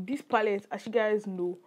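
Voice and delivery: ordinary talk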